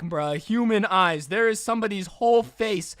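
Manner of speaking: ordinary talk, nothing marked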